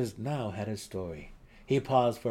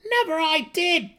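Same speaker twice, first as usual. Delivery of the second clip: high, loud indignant denial; gnome protesting; 10 dB louder than usual. A narrator's reading. Accused gnome protests to his shoving friend, high and loud. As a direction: squeal it back in protest. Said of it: "the other protested".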